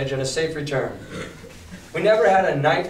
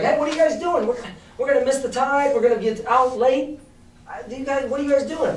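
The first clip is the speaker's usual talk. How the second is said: high, modern-talk retelling of the cook; squeaky mock sailor complaining about the tide; Long John paraphrased; 9 semitones higher, faster than usual. Teacher retelling Long John's complaint in a high mock voice. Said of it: "and he's like".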